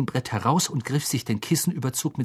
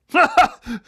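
high, loud braying laugh; booming laugh; 12 semitones higher, 5 dB louder than usual. A narrator's reading. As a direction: let out a big tipsy laugh. Marge laughs.

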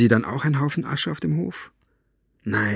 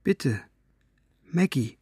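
put-on higher, mildly pleading child's voice; 5 semitones higher than usual. A narrator's reading. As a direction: plead lightly, like a small boy. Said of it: "flehte Pippos Stimme". (leicht flehende, höhere Kinderstimme)

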